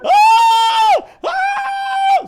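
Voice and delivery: put-on loud, high little-girl scream. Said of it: "I'm screaming like".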